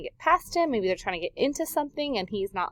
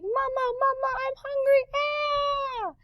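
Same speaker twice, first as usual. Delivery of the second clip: very high, squealing chick voice; baby bird; slower than usual. Pretend. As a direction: cry for mama like a hungry baby bird. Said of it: "these baby birds are saying".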